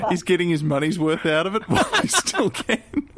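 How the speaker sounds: real laughter breaking into the words; laughing while talking. Real, not acted. Cracks up while joking.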